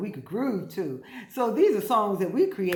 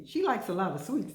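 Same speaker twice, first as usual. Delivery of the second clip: usual speaking voice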